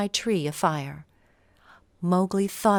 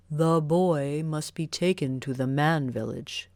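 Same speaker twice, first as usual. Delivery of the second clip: a lightly lowered, grave wolf leader; wolf pack leader; 2 semitones lower than usual. Acted. Wolf leader tells the pack Mowgli must leave, lightly voiced and grave. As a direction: voice the wise old wolf deciding the boy's fate. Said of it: "he said".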